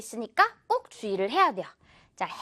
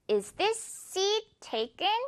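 very slow, "seat" smiled long; slow model question; 7 semitones higher, 4 dB quieter, much slower than usual. Shown as a demonstration. Teacher modelling a traveller's polite question. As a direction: ask it slowly with a smiling seat. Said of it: "seat 미소 발음도 잊지 말고요".

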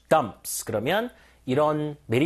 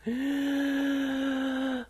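a high, sharp gasp of awe; amazed gasp; 9 semitones higher, 3 dB quieter than usual. Shown as a demonstration. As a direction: gasp as if stunned by the sheer size. Teacher acts amazement at amount.

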